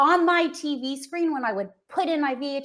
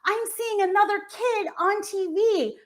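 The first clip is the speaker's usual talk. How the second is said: put-on excited kid; 7 semitones higher than usual. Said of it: "You're like".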